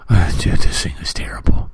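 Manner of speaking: hushed unvoiced whisper, as if sharing a secret. Telling listeners a film is awful, in a whisper. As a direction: lean in and breathe the words. Confiding verdict on a bad film.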